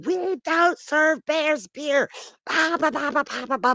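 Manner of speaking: hammed-up high harangue, little extra volume; mock screaming. Same woman screaming at bear, high mock scream. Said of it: "the same woman comes at him screaming".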